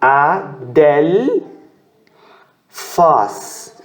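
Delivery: A, del, faz, each syllable set apart; teacher spelling A-del-faz. Shown as a demonstration. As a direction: count out the syllables. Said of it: "Três sílabas aqui".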